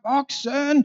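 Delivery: put-on high, pleading child's cry; desperate call. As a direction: call out desperately, nobody answers. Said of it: "råber han endnu mere desperat". (desperat barneråb)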